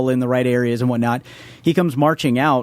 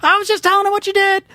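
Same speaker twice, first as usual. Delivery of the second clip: lady yelling from afar. Retold or imitated. Neighbor lady calls to Travis down the street, very high mock yell. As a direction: shout it from far down the block. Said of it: "yells from a distance".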